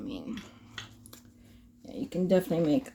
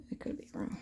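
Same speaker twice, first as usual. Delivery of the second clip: whispered aside; whispering; 13 dB quieter, faster than usual. Real, not acted. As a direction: breathe it under your breath. Aside under breath.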